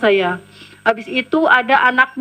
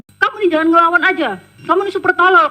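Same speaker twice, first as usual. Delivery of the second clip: higher-pitched imitation of a berating boss; mock telling-off, voice jumping up; retold scolding; 6 semitones higher, faster than usual. Acted employer scolding her.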